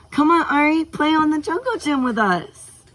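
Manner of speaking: put-on very high, loud pleading kid; high-pitched little girl's eager invitation; excited child. A narrator's reading. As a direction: beg excitedly, bouncing with energy. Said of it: "Skylar began excitingly bounce".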